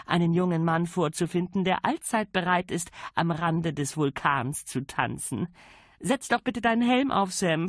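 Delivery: a lady of the manor giving orders gaily; lofty lady issuing cheerful commands; grand lady, brisk and cheerful. A narrator's reading. Lady Sybil voiced by narrator.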